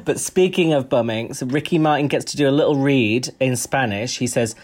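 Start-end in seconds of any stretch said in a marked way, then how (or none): none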